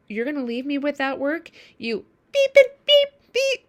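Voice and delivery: high, loud, bleeped insults; angry persecutor. Acted. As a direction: snap at them like a cornered animal. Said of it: "the persecutor, um, is like".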